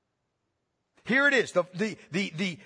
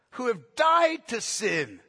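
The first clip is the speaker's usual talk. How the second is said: usual speaking voice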